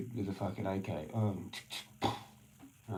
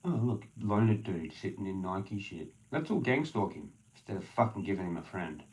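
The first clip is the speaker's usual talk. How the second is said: ordinary talk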